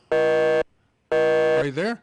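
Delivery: loud, drawn-out call down the line; shouting into a phone. Real, not acted. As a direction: call out to a lost caller, slowly. Call dropped, calling into phone.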